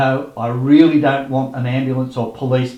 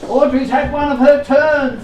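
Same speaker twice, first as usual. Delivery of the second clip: higher, louder put-on worry; feigned alarm; 13 semitones higher, 5 dB louder than usual. Pretends to reception staff that Audrey collapsed, feigned alarm. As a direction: fake the fuss for reception.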